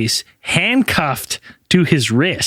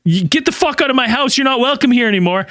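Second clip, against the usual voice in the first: raised, pushed mock scolding; mock angry son; 8 semitones higher than usual. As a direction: throw them out of the house. Son throwing agents out, retold.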